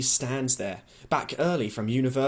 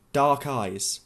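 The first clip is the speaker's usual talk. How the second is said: plain reading voice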